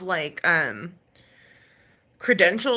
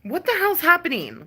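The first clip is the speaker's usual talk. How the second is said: high, startled what the hell; shocked disbelief; 9 semitones higher than usual. Retold or imitated. Confused viewer reacting to the video, voice jumping up high. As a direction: blurt it out in sudden disbelief. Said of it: "'cause I'm like".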